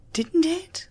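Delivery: usual speaking voice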